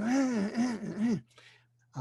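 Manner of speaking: high strained grunts through a throat tube; desperate grunting. Retold or imitated. Patient on a ventilator grunting urgently at the doctor.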